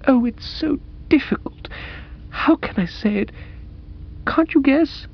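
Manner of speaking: high, halting heroine in distress; soulful young woman, agonised. Acted. Woman unable to say it to Bertie, high and agonised. Madeline struggles to confess.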